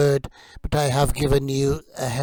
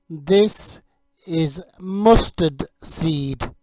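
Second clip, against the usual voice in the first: slow, deliberate "is mustard seed."; slow teaching model; slower than usual. Shown as a demonstration. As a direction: stretch "mustard seed." out for learners. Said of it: "You say it".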